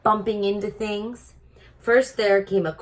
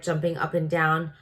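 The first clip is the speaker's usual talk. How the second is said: usual speaking voice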